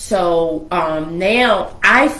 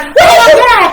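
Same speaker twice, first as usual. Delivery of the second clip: high, loud shocked shout; shocked yelling; 12 dB louder than usual. Retold or imitated. Sister yelling at her brother over the gun, shocked and loud. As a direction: yell at him in disbelief, half laughing.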